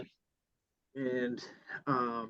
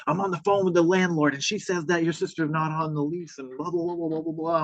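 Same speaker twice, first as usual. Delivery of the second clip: higher-pitched mock rant trailing into babble; ranting neighbor lady; 5 semitones higher than usual. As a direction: imitate the irate neighbor rattling on. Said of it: "lost her mind on me".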